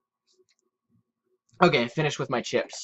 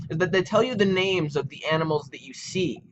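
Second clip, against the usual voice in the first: usual speaking voice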